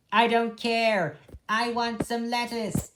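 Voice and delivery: high, loud whiny rabbit voice; petulant rabbit. Acted. Dandelion craving lettuce, high and whiny. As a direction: whine it like a spoilt child.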